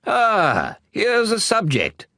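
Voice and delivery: a pleased king's high, loud cry of delight; king exclaims on spotting a visitor; royal exclamation. Acted. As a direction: exclaim like a king who has finally found a subject. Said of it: "the king exclaimed".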